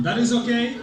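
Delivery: usual speaking voice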